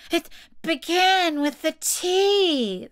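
high, drawn-out reply; plaintive high Hatter voice; nervous Hatter. A narrator's reading. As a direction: explain it high and slow, pleading. Hatter pleads with the King.

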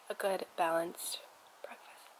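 soft whisper fading out. Real, not acted. To viewers, summing up her breakfast, whispering. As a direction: hush it almost to nothing.

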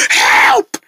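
high, drawn-out terrified cries; screaming for help. Acted. Rabbit attacked by zombie pig, screaming for help. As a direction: wail for rescue.

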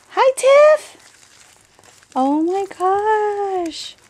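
very high, excited hello into drawn-out amazement; real high, thrilled outburst; excited greeting. Real, not acted. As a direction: greet a friend in high-pitched delight. Delighted greeting of friend's page.

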